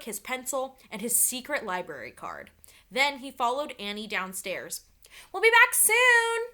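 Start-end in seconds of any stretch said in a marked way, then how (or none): 5.3-6.4 high, loud call back to the house. A narrator's reading. Annie calling back to her family as she leaves, loud and high. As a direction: call it out across the yard. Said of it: "Annie called".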